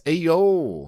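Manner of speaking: distressed exclamation. Shown as a demonstration. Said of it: "expressing distress or surprise".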